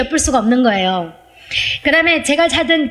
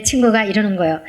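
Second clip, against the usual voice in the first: ordinary talk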